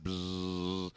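imitated vacuum-cleaner drone; vacuum buzz. Mimicking the stick vacuum guy's machine, droning buzz. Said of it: "the stick vacuum guy".

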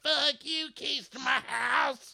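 high, drawn-out mock screech; hammed-up squealing tirade; imitated yelling from the back. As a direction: screech it slowly, way up high, at no great volume. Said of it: "She's in the back yelling, like".

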